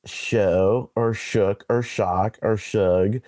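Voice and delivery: show, shook, shock, shug, each vowel distinct; alternative pronunciations. Shown as a demonstration. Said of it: "would be pronounced".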